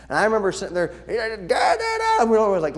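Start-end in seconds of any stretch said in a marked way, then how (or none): 1.1-2.2 high mocking da da; mock droning. Kid mocking the grouchy sub's lesson, high mock drone. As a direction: imitate a droning teacher.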